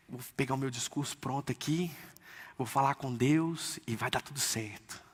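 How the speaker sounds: mock the rehearsed excuse under your breath; smug inner voice. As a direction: believer reciting a ready-made speech to God, quietly smug. Mocks self-reliant prepared speech.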